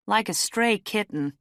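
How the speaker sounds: put-on concerned older woman. A narrator's reading. Middle-aged woman sizing up a bedraggled stranger, concerned. Same woman finishes her remark.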